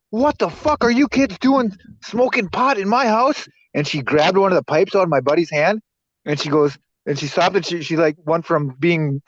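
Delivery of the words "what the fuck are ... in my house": high, slow, furious mom voice; angry mom yelling. Retold or imitated. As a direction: scold the kids like an outraged mother.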